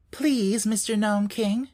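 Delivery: put-on high, polite girl calling out; little girl calling politely into the mountain. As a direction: call to the king sweetly. Dorothy calls the Gnome King.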